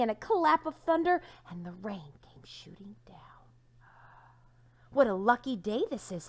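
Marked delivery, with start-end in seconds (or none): 1.5-3.2 whispered narration. A narrator's reading. Storm narration drops to whisper. 4.9-6.3 excited thought. Acted. Donkey thrilled at his magic pebble, excited. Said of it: "thought Sylvester".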